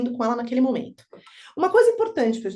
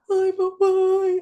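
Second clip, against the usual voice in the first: put-on tender child; 8 semitones higher, 4 dB louder, much slower than usual. Imagined tender daughter.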